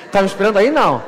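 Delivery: recusa assustada. Retold or imitated. Recusa a Deus com pavor.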